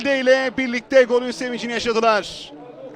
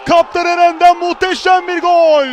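roaring goal call; goal shout; 5 semitones higher, 6 dB louder than usual. Real, not acted. Goal call in live commentary.

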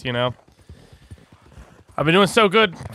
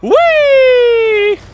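much higher, louder whooping glee; gleeful shout; 9 dB louder, much slower than usual. Real, not acted. To friends from the roof, a gleeful shout. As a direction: crow from the rooftop.

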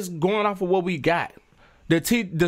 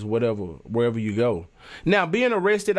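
normal talking voice

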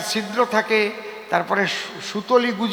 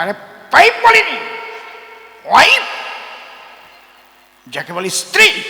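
higher, louder correction in a put-on voice; indignant husband; 6 semitones higher, 5 dB louder than usual. Village husband correcting his friend, indignant and higher. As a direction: insist he said wife, not pipe. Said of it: "কয়".

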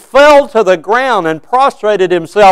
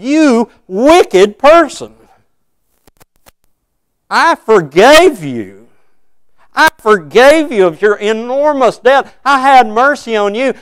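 higher, slow, accusing rebuke; stern judgment; 6 semitones higher than usual. Acted. The Lord grabbing a sinner on judgment day, slow stern rebuke. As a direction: seize them by the collar with each word.

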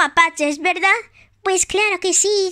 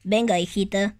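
mother toy voice; 8 semitones lower than usual. Pretend. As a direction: play the gentle mother of Tag. Plays Tag's mother.

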